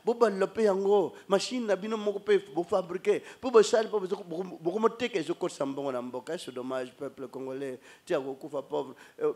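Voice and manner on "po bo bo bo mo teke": ordinary talk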